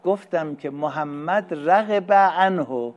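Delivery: slow, deliberate رغب عنه with weight on عنه; stress on عنه. Shown as a demonstration. Teacher contrasting عنه with به, slow and stressed. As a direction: lay the word عنه out slowly for contrast. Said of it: "ان که از حروف جاره است".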